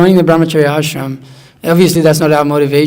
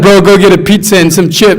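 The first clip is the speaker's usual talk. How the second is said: louder, higher bro voice; hammed-up stoner; mock stoned bro; 3 semitones higher, 6 dB louder than usual. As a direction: play the munchies-craving friend. Quoting stoned friends.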